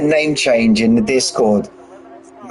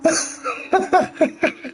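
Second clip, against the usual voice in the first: breathless laugh; 5 dB quieter than usual. Real, not acted. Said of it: "I gotta get myself composed".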